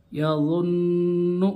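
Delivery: यज़ुन्नु with the नु held long and slow through the nose; slow nasal ghunna on यज़ुन्नु. Shown as a demonstration. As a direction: hum the doubled न in यज़ुन्नु through the nose, slowly. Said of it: "देखिए यहाँ पर कैसा गुन्ना कर रहा हूँ".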